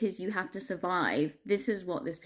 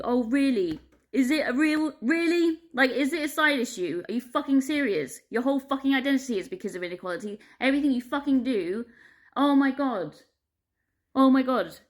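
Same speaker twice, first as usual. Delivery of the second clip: incredulous, higher and louder rant; exasperated; 5 semitones higher, 4 dB louder than usual. Real, not acted. Answering people who dismiss equality, exasperated. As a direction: throw the questions back in real disbelief. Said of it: "It just fucking drives me crazy".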